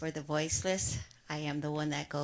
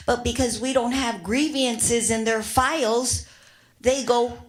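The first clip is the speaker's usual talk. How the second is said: louder, rising anger at the council; impassioned loud plea; 2 semitones higher, 5 dB louder than usual. Real, not acted. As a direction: build to a loud, indignant plea. Angry public plea at council.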